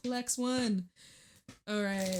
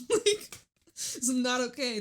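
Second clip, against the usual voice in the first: laughing; 4 semitones higher, 3 dB louder than usual. Real, not acted. Laughing at the reading.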